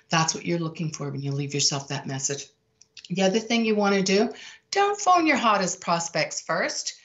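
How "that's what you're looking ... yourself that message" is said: normal talking voice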